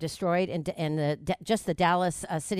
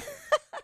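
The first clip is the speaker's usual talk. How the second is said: a quick high laugh; high giggle. Real, not acted. Guest laughing with the host after her joke.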